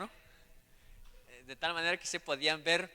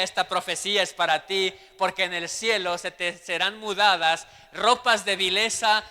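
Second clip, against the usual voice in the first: loud, fervent preaching to the church; 2 semitones higher, 9 dB louder than usual. Acted. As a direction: preach it out with full force. (predicando con fuerza)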